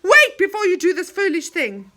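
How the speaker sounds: loud, bold warning; fierce threat. Acted. Moonface threatening the enchanter with the kettle, loud. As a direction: threaten him boldly. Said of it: "went toward the enchanter fiercely".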